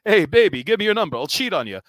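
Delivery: hammed-up lounge-comic come-on; brash comic pickup line. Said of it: "assigning people nicknames as I walk by them and be like".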